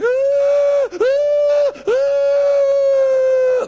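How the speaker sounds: very high, loud wailing cry; long, loud keening howls; mourners' wailing. Acted. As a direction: wail like hired mourners. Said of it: "people wailing".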